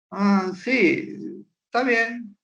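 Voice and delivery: high, limp, disinterested answer; a mock apathetic yes; listless teen reply. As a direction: sound like a teen who doesn't care.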